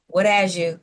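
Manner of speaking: normal talking voice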